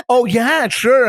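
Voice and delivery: sarcastic heroine brushing off a general; sarcastic sure. Retold or imitated. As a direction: roll your eyes as you agree. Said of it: "I could just see her like".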